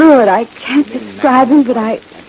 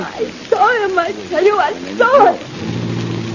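high, distraught wail; hysterical insistence; 8 semitones higher, slower than usual. Acted. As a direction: insist desperately, close to breaking down. Insists hysterically she saw him.

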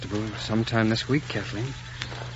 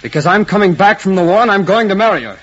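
loud, high, resolute declaration; forceful, unyielding challenge; defiant vow; 8 semitones higher, 8 dB louder than usual. Acted. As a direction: vow it to his face with raised voice. Suitor defies the uncle.

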